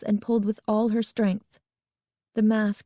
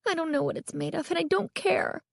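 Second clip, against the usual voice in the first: high, quick, soft grumble; fretful, pitched-up complaint; grumbling; 9 semitones higher, 4 dB quieter than usual. A narrator's reading. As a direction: grumble in frustration. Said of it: "Carly Beth grumbled".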